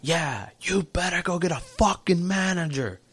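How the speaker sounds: high, slow mock yell; imitating a yelling customer. Customer yells after the walking-away waiter, high slow mock yell. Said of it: "this guy yells".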